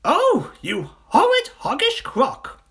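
high, loud monkey outrage; outraged cry. Acted. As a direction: cry out in high-pitched indignation. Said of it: "cried Mugglewump".

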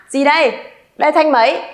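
ordinary talk

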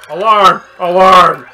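loud barked alarm; hammed-up guard alarm; mock alarm call. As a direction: shout it like a guard. Game alarm call imitated.

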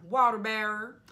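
loud, stretched put-on accent on "Water bearer"; belting "Water bearer" in a silly accent; odd accent. Shown as a demonstration. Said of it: "I don't know where that accent came from".

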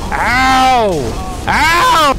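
loud, high yelps of protest; exasperated yelling. Real, not acted. Player getting hit in a game, exasperated high shouts. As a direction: cry out as each hit lands.

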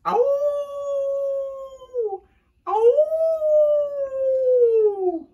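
long, high, loud "awoo"; wolf howl. A narrator's reading. Wolf howls in the night.

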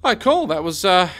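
high, loud startled outburst; jumpy, caught off guard. Real, not acted. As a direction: yelp of surprise then stammer. Startled reaction after drone.

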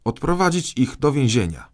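shouted order. A narrator's reading. Boy king sending ministers to prison, shouted command. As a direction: call out the order forcefully. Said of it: "krzyknął Maciuś".

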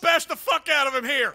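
normal talking voice

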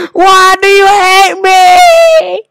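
slow, high, loud, drawn-out scream; anguished wail. Real, not acted. Gamer pleading with the game, anguished wail. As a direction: howl the question in despair.

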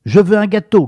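impatient grouch snapping his order; curt demand. A narrator's reading. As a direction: bark the order at the butcher. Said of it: "d'un ton sec".

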